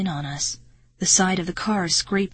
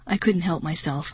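usual speaking voice

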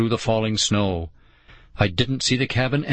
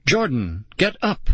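pleading; 5 semitones higher than usual. A narrator's reading. Girl begs collapsed Jordan to rise, pleading. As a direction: pitch leaping up in appeal. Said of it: "Nicole pleaded".

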